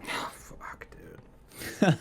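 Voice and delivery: sighed, voiceless whisper; agonised whisper. Real, not acted. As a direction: breathe it out, torn over a hard choice. Agonising over his pick.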